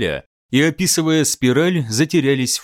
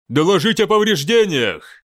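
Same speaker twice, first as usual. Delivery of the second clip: shouting over noise; 5 semitones higher than usual. Acted. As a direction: shout above the bridge noise. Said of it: "крикнул Мхотеп, перекрикивая шум".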